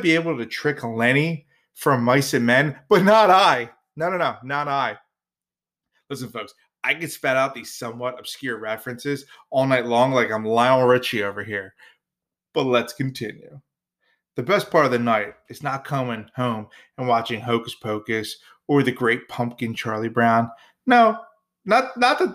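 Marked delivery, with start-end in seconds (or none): 2.9-5.0 hammy defiance, big on the first not I; mock heroic brag with a big jump up in pitch; defiant boast. 20.9-22.3 No jumps way up, high and loud; a quick high backpedal; sudden correction. Real, not acted.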